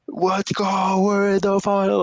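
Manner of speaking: high, put-on excited fan voice; terrible fan impression. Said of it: "a terrible impression".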